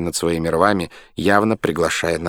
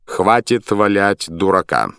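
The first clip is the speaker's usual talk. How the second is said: slow, firm and loud; 5 dB louder, slower than usual. A narrator's reading. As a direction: put a stop to it, firmly. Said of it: "решительно сказал Суир".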